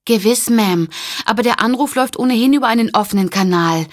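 a flustered operator calling to get through the crowd. Acted. Operator voice, high and urgent.

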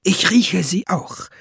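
lightly voiced fox, hissing; hissed. A narrator's reading. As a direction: hiss it, drawn out. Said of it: "zischte Fuchs".

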